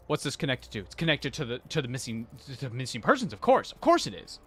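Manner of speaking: normal talking voice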